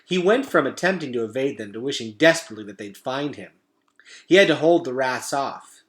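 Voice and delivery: plain reading voice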